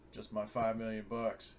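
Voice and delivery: ordinary talk, nothing marked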